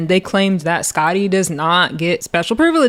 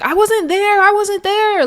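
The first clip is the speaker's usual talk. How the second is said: high, whiny put-on voice repeating the excuse; 12 semitones higher than usual. [name] denying she was there, in a high mock voice. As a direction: pitch it up like a defensive girl.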